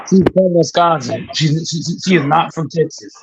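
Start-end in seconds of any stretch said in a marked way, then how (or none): none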